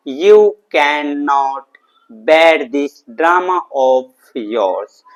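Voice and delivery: very slow, each word stretched out and separated; slow model sentence. Shown as a demonstration. English teacher giving students the answer, slowly and deliberately drawn out. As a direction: dictate it word by word for learners.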